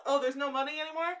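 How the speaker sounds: ordinary talk, nothing marked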